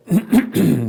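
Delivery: rough throat clear. Real, not acted. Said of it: "because of my voice".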